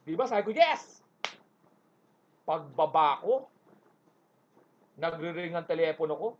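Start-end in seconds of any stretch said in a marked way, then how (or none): 0.5-1.0 triumphant yes. Retold or imitated. Celebrating escape.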